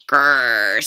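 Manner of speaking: low, loud growl; father's growl. A narrator's reading. Father thwarted by the fish, growling. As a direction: growl "grrr" in frustration.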